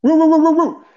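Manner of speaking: high, loud party whoops; excited whooping. Acted. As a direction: whoop it up like a crowd. Host cheers after intro.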